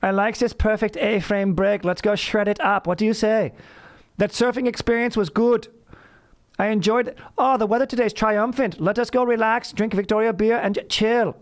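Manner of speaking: high, formal German-Aussie surfer bro; German Australian dude voice. Pretend. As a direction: stiff German diction with surfer slang. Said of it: "It's my Australian German dude".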